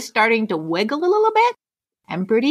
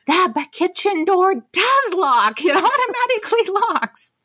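retold indignant protest; 9 semitones higher than usual. Wife corrects husband about the kitchen door, indignant protest. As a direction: hammer it home in a high, exasperated voice.

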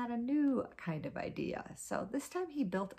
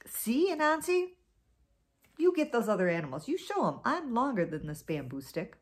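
louder, proud crowing; boastful; 3 semitones higher, 4 dB louder than usual. Acted. Snake showing off beside the bamboo, boastful and louder. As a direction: brag like you've already won. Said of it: "and he said".

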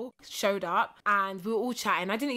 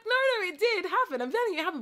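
insistent; 9 semitones higher, much faster than usual. Retold or imitated. Insisting the story is true.

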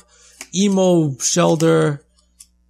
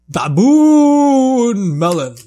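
silly voice; 7 semitones higher, 9 dB louder, much slower than usual. Pretend. Silly card name.

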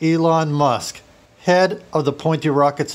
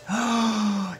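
soft high gasp of discovery; revelation gasp; 7 semitones higher, 5 dB quieter than usual. Acted. Burglar discovering the colour secret, revelation gasp.

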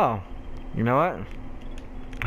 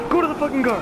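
much higher, more frantic order; insistent urgent yell; 12 semitones higher, faster than usual. Real, not acted. Player repeating order to reach the car, insistent yell. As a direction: yell it, punching the start.

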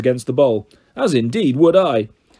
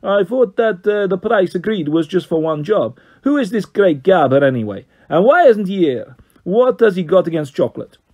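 higher Italian voice, pressing; Carlos questioning; 5 semitones higher, 4 dB louder than usual. A narrator's reading. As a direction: push for answers in Italian.

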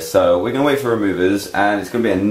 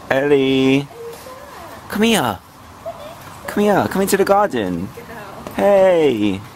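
calling out; 7 semitones higher, slower than usual. Real, not acted. Calling dog into garden.

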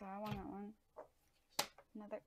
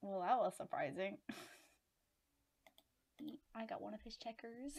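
ordinary delivery

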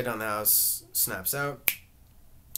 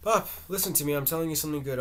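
desperate, higher and louder plea; 5 semitones higher, 5 dB louder, faster than usual. A narrator's reading. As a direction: beg him to listen, rising. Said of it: "Biff, desperately".

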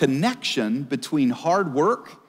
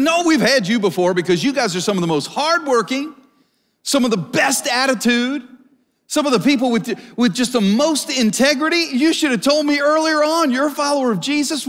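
higher, eager praise; delighted employer; 8 semitones higher than usual. Acted. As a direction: gush with approval like a thrilled boss.

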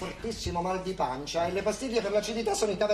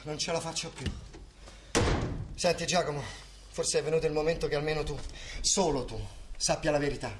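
soft, low, tired voice; weary and solemn; 8 semitones lower, 7 dB quieter than usual. Acted. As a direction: confess the truth wearily. Weary, solemn confession to son.

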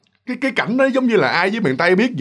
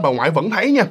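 ordinary talk, nothing marked

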